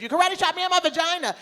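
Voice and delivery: high, loud, fast mock scream. Comic yelling at the TSA agent in a high, loud mock scream. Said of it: "I screamed".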